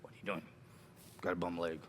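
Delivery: low, soft pirate aside; pirate off-lines. Shown as a demonstration. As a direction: mumble to yourself in pirate character. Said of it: "even when I don't have lines".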